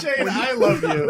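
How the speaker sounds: through laughter, high and slow; laughing. Real, not acted. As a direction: gush while laughing. Laughing affection after joke.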